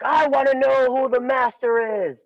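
put-on high, brash young hotshot voice; cocky challenger. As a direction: barge in and demand the master.

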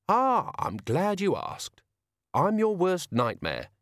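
high, slow, cheerful villain; cheerful menace. A narrator's reading. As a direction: announce yourself with drawn-out relish. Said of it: "said Tea Time cheerfully".

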